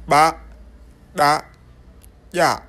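"Ba, da, ya" each begun with an implosive consonant. Shown as a demonstration. Teacher listing the three Pulaar implosives, "Ba, da, ya" said implosively. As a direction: separate implosive "Ba", "da", "ya". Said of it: "trois consonnes implosives".

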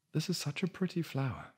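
high, sweet delight; high admiring voice. Acted. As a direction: gush over it, pitched high. Woman admires the flower.

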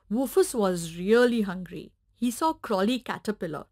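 plain reading voice

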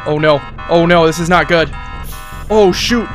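usual speaking voice

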